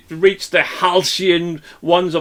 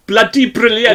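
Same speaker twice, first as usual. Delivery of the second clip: lilting Welsh accent, a little higher; higher-pitched Welsh accent; 4 semitones higher, 3 dB louder than usual. Shown as a demonstration. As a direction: say it with a Welsh lilt. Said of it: "I'm gonna say it in my Welsh accent".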